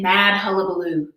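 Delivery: usual speaking voice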